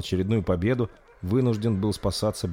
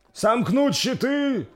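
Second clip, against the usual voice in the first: slow, high carrying call; echoing order; 4 dB louder, much slower than usual. Acted. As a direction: call it across the army. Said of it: "разнеслось по всему войску".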